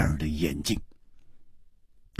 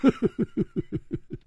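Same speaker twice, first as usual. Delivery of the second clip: soft, high, breathy chuckle; a string of light chuckles; laughing; 9 semitones higher, 6 dB quieter than usual. Acted. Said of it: "他笑着大声说".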